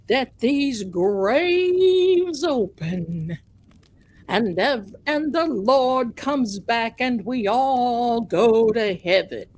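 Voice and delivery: slow, drawn-out Southern pastor impression; mock Southern preacher. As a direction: draw out the key words in hammed-up Southern preaching. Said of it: "he's got this Southern accent".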